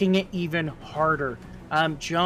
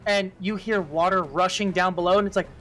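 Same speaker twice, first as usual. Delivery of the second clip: usual speaking voice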